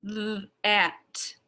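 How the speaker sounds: "th, a, t" broken into sounds, voiced th; sounding out. Shown as a demonstration. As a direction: separate "th, a, t" sound by sound. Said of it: "I'm gonna sound it out".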